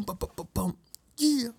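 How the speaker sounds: high mock drum fill; imitated beat drop. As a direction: vocalize a silly breakdown beat, high. Mimicking a music breakdown.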